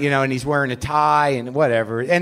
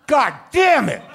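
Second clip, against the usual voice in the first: high, loud cry of delight; ecstatic yell; 8 semitones higher, 4 dB louder than usual. Pretend. Acting out first bite of the cake pop, loud ecstatic shout. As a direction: shout like the cake pop blew your mind. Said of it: "the experience I had when I ate my first cake pop".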